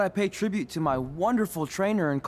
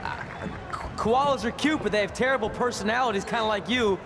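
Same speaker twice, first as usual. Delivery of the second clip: put-on Australian accent; 8 semitones higher than usual. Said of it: "Anything she says with that accent is funny".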